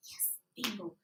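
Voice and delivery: gleeful secret whisper; whispered triumph. Acted. Girl celebrates her secret win under her breath, whispering. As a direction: whisper the win so nobody hears. Secret victory after the trick.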